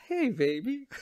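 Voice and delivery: high crooning "Hey, baby" in a mock groovy voice; hammed-up groovy baby; groovy Hitler. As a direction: croon it like a lounge lizard. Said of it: "he's just like".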